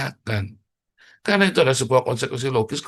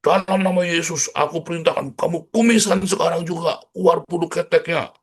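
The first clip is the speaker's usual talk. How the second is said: high, loud hammed-up commanding prayer; shouted mock exorcism, in Jesus' name; 8 semitones higher, 5 dB louder than usual. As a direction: bark it like a faith-healer forcing a miracle. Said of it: "kamu pasak".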